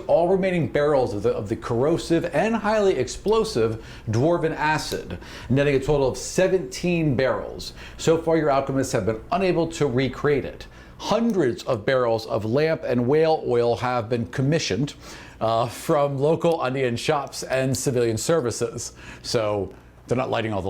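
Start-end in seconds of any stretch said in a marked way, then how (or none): none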